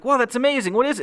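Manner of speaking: high, loud eager voice; character thrilled to get an autograph, high and loud; mock excitement. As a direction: gush like a starstruck fan. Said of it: "And it's like".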